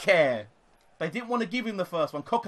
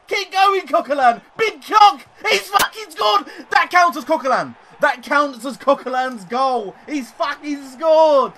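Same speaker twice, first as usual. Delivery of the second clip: screaming, much higher and louder; real euphoric goal yell; goal celebration shout; 8 semitones higher, 9 dB louder than usual. Real, not acted. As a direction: erupt as the ball goes in.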